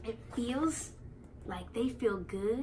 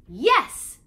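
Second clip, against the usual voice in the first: yes shouted much louder, little higher; joyful yell; 6 semitones higher, 13 dB louder than usual. Acted. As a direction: shout it out happily. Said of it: "yells, joyously".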